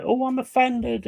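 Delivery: high whiny mock voice; mocking the easily offended, pitched high. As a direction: mimic a touchy, offended person.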